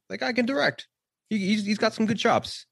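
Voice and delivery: high, thin creature voice; Gollum voice. Pretend. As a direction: squeak it out like Gollum. Joking about the Gollum guy.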